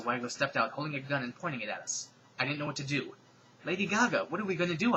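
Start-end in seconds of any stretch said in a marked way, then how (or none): 3.6-4.9 panicked. Acted. Panicked question at gunpoint.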